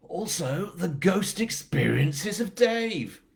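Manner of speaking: high, slow mock-horror announcer; drawn-out spooky showman; spooky title announcement. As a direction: announce it like a hammed-up ghost show.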